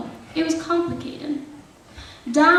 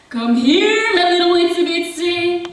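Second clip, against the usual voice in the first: higher, lilting mock summons; husband calling out; 6 semitones higher, 4 dB louder than usual. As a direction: coax her out with a singsong call. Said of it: "he called out to her".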